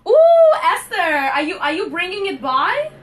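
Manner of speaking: high, loud, delighted ooh and question; excited squeal. Real, not acted. Excited at viewer's ice cream cake.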